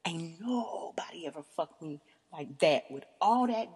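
breathy whispered repeat for emphasis; hushed, voiceless disbelief; awed whisper. Retold or imitated. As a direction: whisper it as if stunned.

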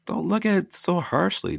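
husband lightly pleading with his wife; begging. Acted. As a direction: beg her gently not to take it badly. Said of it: "Dustin begged".

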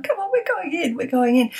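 high excited call to a friend in the car; yelled out. Retold or imitated. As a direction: shout it across, thrilled.

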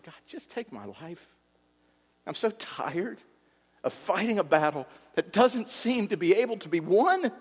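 weary plea. Retold or imitated. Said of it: "He said".

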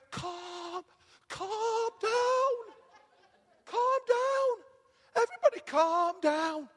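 very high, drawn-out crooning; mock placating. [name] hushing Steve and everybody, exaggerated high placating. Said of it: "You know, people just".

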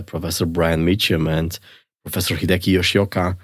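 usual speaking voice

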